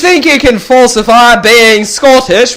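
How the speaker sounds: put-on high, loud Scottish accent drifting Australian; mock Scottish accent. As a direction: ham up a Scot who slips into Aussie. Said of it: "increasingly Australian".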